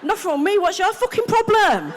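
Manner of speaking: high, laughing defiant snap back; mock aggressive retort. Comic retorting to everyone who asks, high and mock aggressive. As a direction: throw it back with attitude. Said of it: "my natural response".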